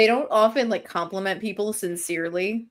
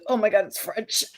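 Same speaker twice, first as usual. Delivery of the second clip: mock alarm; 4 semitones higher than usual. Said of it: "Mac just goes".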